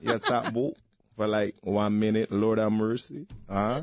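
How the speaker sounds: loose Jamaican patois; amused Jamaican impression; lilting mock-Jamaican talk; Jamaican accent. Shown as a demonstration.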